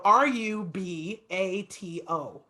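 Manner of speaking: R U B A T O spelled letter by letter, slow; spelled out slowly. Shown as a demonstration. Teacher spelling the word slowly for the student. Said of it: "So I'll spell it".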